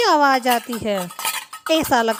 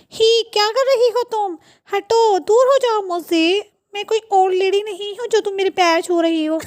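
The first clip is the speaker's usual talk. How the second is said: high-pitched affronted scolding; indignant protest; 5 semitones higher than usual. Acted. Friend objecting to Kamlesh touching her feet, indignant. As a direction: recoil with a raised, offended voice.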